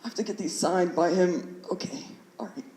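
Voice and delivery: very soft, trailing almost to nothing; reluctant talking yourself into it, dropping away; muttering to self. Retold or imitated. Student psyching himself up to face the teacher, muttered quietly.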